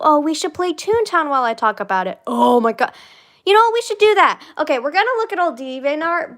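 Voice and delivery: high loud excited idea. Real, not acted. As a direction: get excited about the plan.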